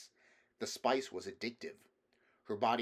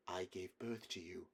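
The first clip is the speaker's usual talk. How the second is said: much softer and lower, steady; quiet it down to calm him; a mother settling her son; calm reassurance; 3 semitones lower, 9 dB quieter than usual. A narrator's reading. Answer meant to calm him.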